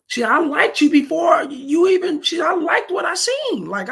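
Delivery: high-pitched imitation of her; quoted woman's complaint. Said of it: "she said it just like that".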